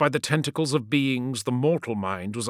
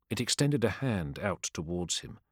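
plain reading voice

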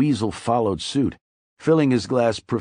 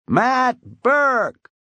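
high, loud drawn-out holler; bawled name; 5 dB louder than usual. A narrator's reading. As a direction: call him over the crowd, long and pitched up. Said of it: "Weasel waved wildly".

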